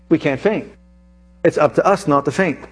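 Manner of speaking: ordinary talk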